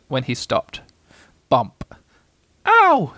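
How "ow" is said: very high, loud little squeak; startled squeak. A narrator's reading. Small creature someone fell on, squeaking. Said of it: "squeaked something".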